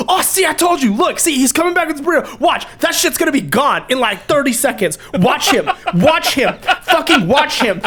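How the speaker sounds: high, eager hype of a coworker pointing him out; excited coworker. Retold or imitated. Coworker hypes the crowd to watch him, high and eager. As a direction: egg the others on to watch.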